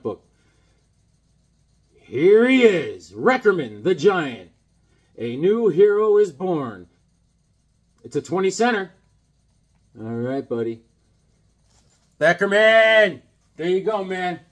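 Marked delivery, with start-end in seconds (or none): 1.9-6.9 slow, loud hero introduction; grand mock comic-book fanfare; announcer. Proclaiming the new comic hero. 12.2-13.2 long, loud, higher holler; calling out. Pretend. Calling out to his pal.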